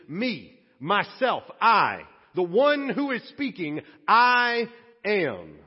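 rising from plain to a loud "I am"; building emphasis. Shown as a demonstration. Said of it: "what Jesus is really saying".

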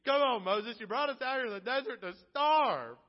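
loud, exasperated outcry at Moses; complaining shout. Retold or imitated. Israelites yelling at Moses over hunger, loud exasperated complaint. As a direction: yell it like a fed-up, starving crowd. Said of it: "they go to Moses and they say".

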